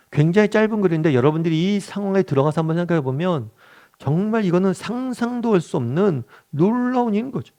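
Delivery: usual speaking voice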